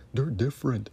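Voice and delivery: sarcastic mock voice with a changed timbre; mocking the critics. Host mocks critics who defend TV networks, sneering mock voice. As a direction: parrot their excuse, sneering. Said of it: "That's what you're saying".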